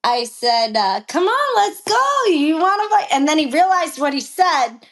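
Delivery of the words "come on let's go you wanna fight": high-pitched retold challenge to fight; defiant dare. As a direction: goad him, acting out the quarrel. Acted dare in retold quarrel.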